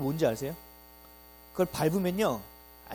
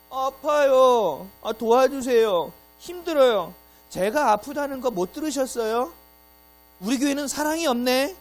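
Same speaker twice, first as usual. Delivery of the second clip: high, loud whining, dragged out; mock a member begging for help; hammed-up needy churchgoer moaning; whiny complainer; 6 semitones higher, 8 dB louder, slower than usual. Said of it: "이런 얘기만 한다는 거죠".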